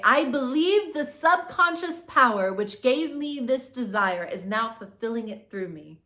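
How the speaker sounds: a firm affirmation said for the listener to repeat. Shown as a demonstration. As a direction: proclaim it slowly and firmly. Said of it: "And also say".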